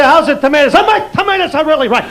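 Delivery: high, hammed-up tomato seller; fruit stand vendor. Son as fruit seller praising tomatoes, hammed-up vendor. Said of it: "just give him a fruit stand".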